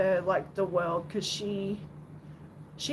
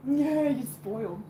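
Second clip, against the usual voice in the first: pet talk; 5 semitones higher than usual. Real, not acted. Telling her cat it is spoiled, cooing pet talk. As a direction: coo it at the cat.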